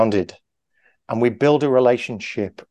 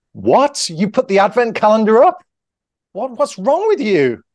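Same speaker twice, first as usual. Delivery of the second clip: high, loud, hammed-up disbelief; over-the-top outrage; 10 semitones higher, 5 dB louder than usual. Shown as a demonstration. Improv partner overreacts to a mundane line, hammed-up loud outrage. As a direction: explode over nothing, way too big. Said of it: "a totally over-the-top emotional reaction".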